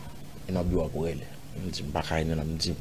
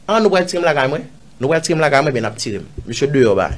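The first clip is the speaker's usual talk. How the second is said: raised, higher voice imitating a threat; retold threatening order; 6 semitones higher, 7 dB louder, faster than usual. Man imitating police threatening at his house, forceful. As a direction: act out officers ordering people about, loudly.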